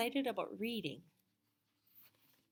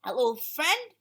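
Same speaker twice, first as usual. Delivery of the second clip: high, loud friendly dragon voice; Duncan greeting; 5 semitones higher, 12 dB louder, slower than usual. Acted. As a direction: greet the raccoon brightly. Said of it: "he said to the raccoon".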